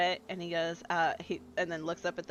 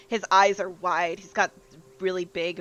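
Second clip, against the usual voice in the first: ordinary talk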